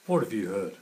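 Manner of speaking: loud demanding question; fox asking. Acted. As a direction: press the hare for news. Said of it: "He asks the hare".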